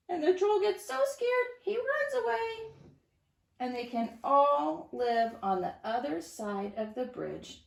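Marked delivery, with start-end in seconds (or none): 0.1-2.7 higher, animated story voice; a teacher telling children the troll runs away scared; excited storytelling. A narrator's reading. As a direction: make the troll's fright exciting for kids.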